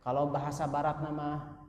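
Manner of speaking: ordinary talk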